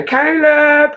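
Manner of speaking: high, loud call of Caleb's name. Retold or imitated. Aunt calling her nephew through the house, loud and high. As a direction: call to him from the doorway.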